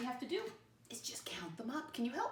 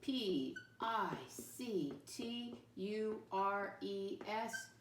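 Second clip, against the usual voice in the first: spelling out letters; much slower than usual. Shown as a demonstration.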